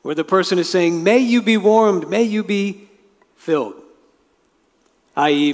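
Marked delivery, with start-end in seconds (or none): 1.0-3.8 bestow it like a blessing; gentle benediction. Acted. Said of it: "a kind of prayer, more of like a blessing".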